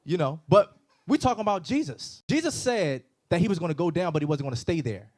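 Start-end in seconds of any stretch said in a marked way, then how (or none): none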